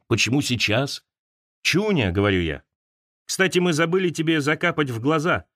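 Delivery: ordinary delivery, nothing marked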